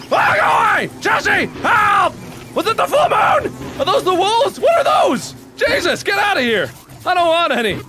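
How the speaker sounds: panicked screaming. Real, not acted. Screaming for help at wolves.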